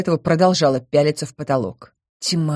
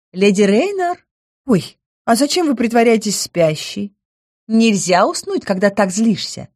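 high cheerful nymph voice; pixie; 5 semitones higher than usual. Acted. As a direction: call out bright and bubbly. Nymph calls again, high.

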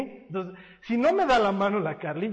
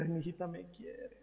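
much softer, melting fond sigh; tender sigh; 2 semitones lower, 13 dB quieter than usual. Retold or imitated. Pastor recalling his daughter's hug, soft tender sigh. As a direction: coo it like a touched father.

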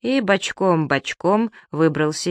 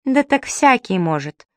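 put-on slow, high girlish dismissal; disappointed; 7 semitones higher than usual. A narrator's reading. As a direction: draw it out like an unimpressed little girl. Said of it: "разочарованно протянула девочка". (разочарованно, протяжно, тонким голоском)

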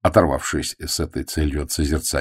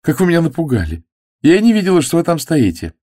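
put-on high startled cry of a young woman; a girl startled in the garden; 11 semitones higher, 4 dB louder than usual. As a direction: caught off guard, pitch jumping up. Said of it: "Девушка вздрогнула".

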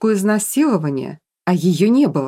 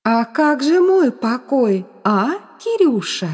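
slow, higher taunting question; mocking; 6 semitones higher, slower than usual. A narrator's reading. Ghost taunts Kirill over her own rest, mocking. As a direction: draw out the taunt with a smirk. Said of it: "усмехнулась Софи".

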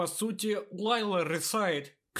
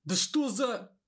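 hysterical; 6 semitones higher than usual. A narrator's reading. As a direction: lose it in panic. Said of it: "Истерично повернулся он".

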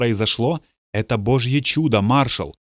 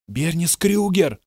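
muffled voice; 6 semitones higher, slower than usual. A narrator's reading. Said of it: "произнёс приглушённый женский голос".

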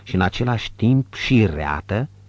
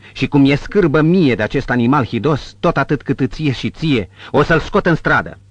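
raised, louder, threatening voice; angry resolve; 6 semitones higher, 5 dB louder than usual. A narrator's reading. Protector vows to throw the brute out, angry. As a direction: push pitch and volume up in disgust.

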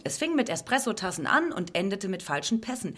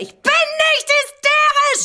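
very high, loud, slow shriek; hysterical scream; 11 dB louder, slower than usual. Acted. Woman screams her denial at those calling her hysterical. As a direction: drag out the scream, stress on nicht. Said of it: "sie ist hysterisch".

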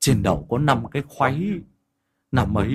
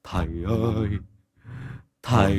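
low, soft, broken moan; moaning lament; 4 semitones lower, 4 dB quieter, faster than usual. Acted. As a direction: groan for the master from far away. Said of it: "kêu than mà khóc lóc". (rên rỉ trầm, nhỏ, ngắt quãng)

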